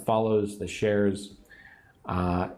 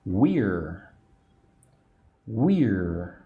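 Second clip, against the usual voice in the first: slow; 3 semitones lower than usual. Shown as a demonstration. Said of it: "Can you speak slowly this word".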